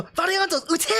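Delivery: high, punchy call with heavy emphasis; imitating the Japanese voice actress; emphatic Japanese command. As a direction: hit ute hard like a battle order. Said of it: "she says it with this huge emphasis".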